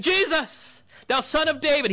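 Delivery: very high, loud desperate yell. Retold or imitated. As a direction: shout over the crowd to be heard. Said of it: "He's yelling it out".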